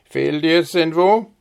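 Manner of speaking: sleepy father. A narrator's reading. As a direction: still half asleep, asking if she is ill. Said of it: "rief er mit schläfriger Stimme".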